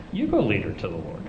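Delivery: low, soft, playful retort; being cute. Retold or imitated. Joker to his friend's wife, playfully cute. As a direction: toss it back softly as a tease. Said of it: "I was just being cute with her".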